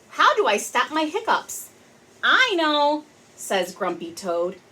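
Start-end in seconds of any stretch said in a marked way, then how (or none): 0.1-1.7 kitty voice. Acted. Pete's question in the story. 2.2-3.1 grumpy toad. Acted.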